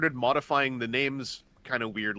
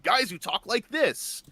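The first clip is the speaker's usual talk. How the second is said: slow, higher ordinary-guy read; plain human voice; 6 semitones higher, slower than usual. Shown as a demonstration. Showing how the dub voices sound, a plain guy voice. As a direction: say it like a regular dude, not a cartoon. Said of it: "voices tend to just be like".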